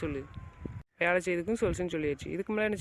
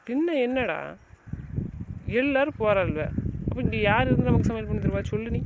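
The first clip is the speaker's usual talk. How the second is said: raised, higher voice in indignant complaint; angry protest; 4 semitones higher, 4 dB louder than usual. Acted. Arguing over who will cook if everyone leaves, angry. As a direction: protest loudly, pitch pushed up.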